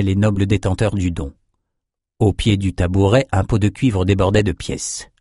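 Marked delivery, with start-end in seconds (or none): none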